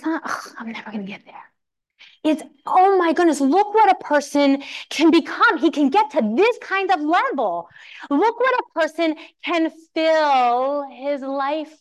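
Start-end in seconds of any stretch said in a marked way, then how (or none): 0.2-1.4 defeated, breathy whisper; a whispered groan of hopelessness; despairing whisper. Pretend. Mocking the discouraged reading. 2.6-11.8 lightly acted awe, slowing at the end; inspired awe. Contrasting inspired reading.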